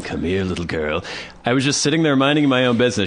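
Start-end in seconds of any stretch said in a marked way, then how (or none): 0.0-1.0 low, soft luring voice; hammed-up stranger coaxing, pitched down; mock creep. Storyteller jokes about luring with candy, low creepy voice.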